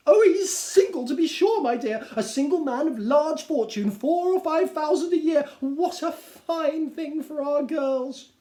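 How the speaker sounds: delighted Mrs Bennet. Acted. Wife gushing about a rich bachelor, high and thrilled. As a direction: relish every word.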